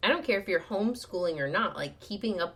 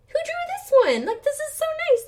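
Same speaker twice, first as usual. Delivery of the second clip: high, loud gushing compliment; gushing admirer; 8 dB louder than usual. Retold or imitated. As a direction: coo in delight like an impressed visitor. Acting out admiring visitors.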